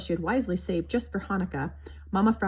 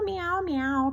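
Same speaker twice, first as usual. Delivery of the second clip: kitten meow; 10 semitones higher than usual. A narrator's reading. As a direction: keep mewing like a kitten. Kitten crying from the tree.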